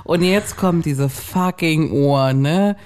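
usual speaking voice

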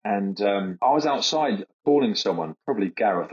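normal talking voice

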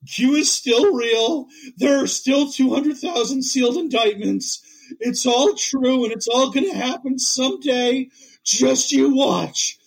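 high, very slow, insistent mock voice; true believer holding out. Grifter begging everyone to keep waiting, mock-earnest insistence. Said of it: "he's just Linus in the pumpkin patch".

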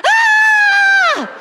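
high drawn-out excited "ah"; imitated baby's excited squeal. Comedian imitates her baby thrilled by the story, excited squeal. Baby reacts once more.

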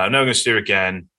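a hurried, insistent echo; urgent repeat. Retold or imitated. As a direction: blurt it out again quickly. The same thought repeated with more force.

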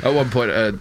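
normal talking voice

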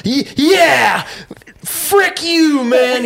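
high, loud imitated taunt; mock defiant yell. Customer backing out of dealership at boss, high defiant yell. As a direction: yell a clumsy parting shot, voice way up. Parting insult to boss, retold.

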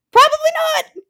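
very high, loud squeak; mock squeaky voice. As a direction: squeal it out in mockery. Sarcastic answer to movie line.

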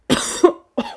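harsh choking cough; coughing fit. Real, not acted. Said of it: "Je m'étouffe".

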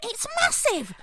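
high, squeaky mum voice; mum's voice. Retold or imitated. Mum telling daughter about the ring, high and awed.

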